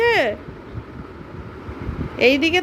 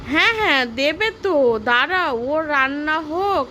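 ordinary talk

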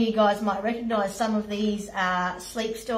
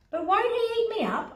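put-on scared child voice; 12 semitones higher than usual. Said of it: "asked William".

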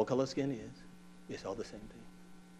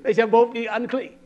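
loud, raised scripture declaiming; forceful preaching; 6 semitones higher, 14 dB louder than usual. Real, not acted. Preacher reading Leviticus on copulation to the congregation, forceful preaching. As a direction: proclaim the verse to the congregation.

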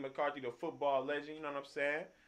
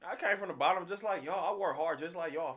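higher, louder, insistent protest; emphatic retold quote; 7 semitones higher, 6 dB louder than usual. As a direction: argue the point hard to the crowd. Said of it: "he said".

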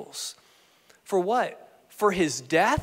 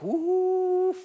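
long, high held "ooh"; imitated delighted wonder; impressed reaction; 10 semitones higher than usual. Jesus delighted by Peter's answer.

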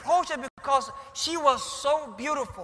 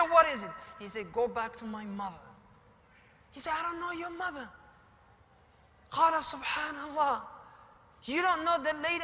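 puzzled questions; 7 semitones higher than usual. Acted. Abu Qudama's puzzled questions to boy.

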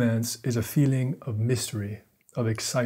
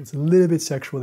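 louder emphatic repeat; 4 semitones higher, 7 dB louder than usual. Real, not acted. Vlogger insisting to viewers, louder emphatic repeat. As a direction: punch it out louder and higher.

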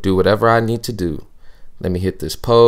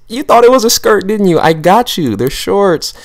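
much higher, louder playful gloating; gleeful teasing; 10 semitones higher, 7 dB louder than usual. Acted. Host teases viewers who thought it was a skirt. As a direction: gloat like you just pranked them.

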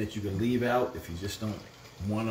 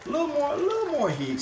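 sing-song high call; 4 dB louder than usual. Real, not acted. Cooking show, calling for heat.

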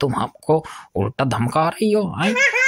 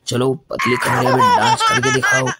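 mocking demand; 3 dB louder than usual. Teasing girl, demanding dance.

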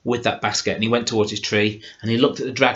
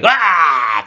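sharp, loud, high roar of pain; the wounded dragon bellows as the arrow strikes; dragon's pained cry; 6 semitones higher, 7 dB louder than usual. A narrator's reading. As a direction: beast screams with an arrow in its eye. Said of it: "cried the dragon".

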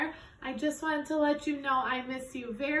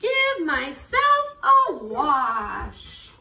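slow drawn-out; 5 dB louder, much slower than usual. Acted. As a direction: stretch every word slowly. Story line read for kids.

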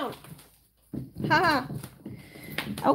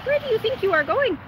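high playful scolding; 7 semitones higher, 3 dB louder than usual. Real, not acted. Repeated mock scolding.

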